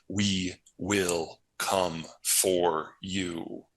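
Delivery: one word at a time; spaced out like a solemn vow; slow, deliberate pledge. Real, not acted. Government promising its captured citizens rescue, slow and deliberate.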